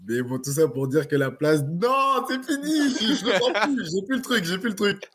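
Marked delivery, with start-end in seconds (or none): 1.6-5.1 cracking up mid-line. Real, not acted. Laughs after punchline spoiled.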